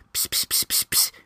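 soft wordless "psst-psst" whisper noise; imitated whispering. Imitating considerate moviegoers chatting, hushed whisper noises. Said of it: "talking in that whisper voice".

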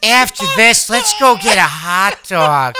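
Coolidge impression. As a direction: purr it like the diva. Keeps doing the impression.

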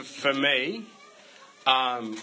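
ordinary talk, nothing marked

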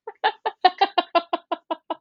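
bright high burst of laughter. Real, not acted. Laughs at guest's remark.

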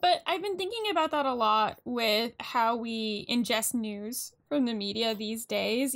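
normal talking voice

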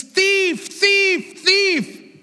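high, accusing repeated cry; imitating an angry yell of thief. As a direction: shout "thief" at him like he robbed you. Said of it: "begins to yell".

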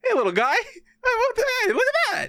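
put-on talking sweetly to a dog in a stroller, high baby voice. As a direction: coo at the little dog like it's a baby. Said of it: "And I'm like".

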